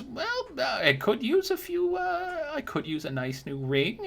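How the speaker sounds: put-on high, sweet old woman teasing; a crone batting her lashes at a customer; high granny voice, playfully flirty. Old woman hints for a ring.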